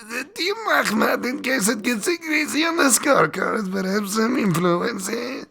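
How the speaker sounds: put-on strained high Borat-style Kazakh accent. Host doing Borat accent about Team Ahmad. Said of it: "I do this strain Borat for Team Ahmad".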